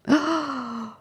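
sharp high gasp of alarm; frightened gasp. Acted. Sita hears Rama calling for them, frightened gasp.

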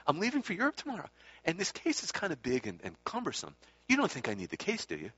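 high, quick wheedling imitation; retold Swami's inflection. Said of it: "Exactly the same inflection even".

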